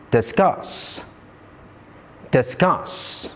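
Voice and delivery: "Enjoy", "Decide", "Discuss", each stressed on its last syllable; stress on second syllable. Shown as a demonstration. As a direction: model each word, weight on "joy", "cide", "cuss". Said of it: "the common stress pattern in these words".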